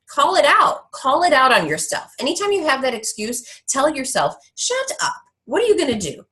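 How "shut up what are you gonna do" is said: self-scolding. Pretend. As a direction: snap at your own excuse. Telling oneself off.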